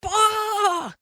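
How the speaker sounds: high, drawn-out calf cry; long high-pitched bawl. A narrator's reading. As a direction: bleat like a startled calf. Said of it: "said Star".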